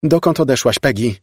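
wyższy głos, głośniejszy początek; zawołaj za kimś, wysoko i pytająco; wołanie w dal. A narrator's reading. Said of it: "zawołała". (wołanie za kimś)

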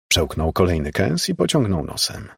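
ordinary delivery, nothing marked

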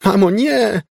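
very high, loud plea; anguished cry. A narrator's reading. Elizabeth begs mother to stop, anguished cry. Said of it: "wołała w potwornej udręce Elizabeth".

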